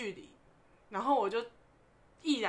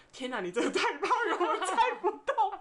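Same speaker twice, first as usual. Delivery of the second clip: imitating the brother, higher and louder, through laughter; laughing brother impression; 9 semitones higher, 3 dB louder than usual. Brother pedalling, tells sister she's too heavy, laughing. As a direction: laugh out that he can't carry her.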